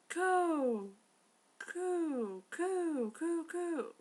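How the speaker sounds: high mock cuckoo calls; high, loud cuckoo coos; cuckoo clock call.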